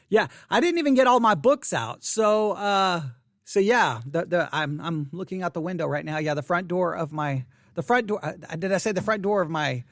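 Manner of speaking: ordinary talk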